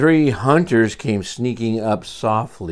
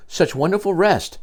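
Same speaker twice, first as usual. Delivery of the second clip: plain reading voice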